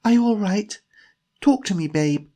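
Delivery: high, loud, worried questioning; anxious urging. Acted. Jemima checks on dazed Timothy, anxious high voice.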